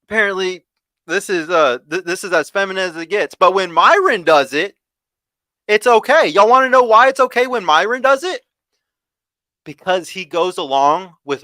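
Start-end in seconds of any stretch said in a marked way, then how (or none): 3.3-8.4 raised, higher and louder rant; fired-up louder pitch; passionate. Real, not acted. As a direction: push it out loud and heated, calling them out. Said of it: "I'm not a man if I talk like this".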